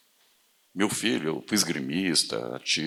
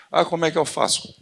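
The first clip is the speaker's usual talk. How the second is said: mock whiny question; 9 semitones higher, 3 dB louder than usual. Mocking a hesitant person.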